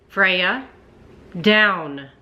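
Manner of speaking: loud, firm dog command. Real, not acted. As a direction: order the dog down.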